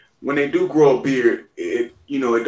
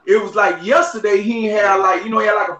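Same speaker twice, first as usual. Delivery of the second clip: excited; 7 semitones higher than usual. Real, not acted. Hyping up a friend's beard.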